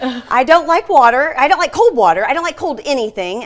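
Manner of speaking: loud, insistent complaining; emphatic protest. Real, not acted. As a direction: really push the complaint, loud. Emphatic dislike of cold water.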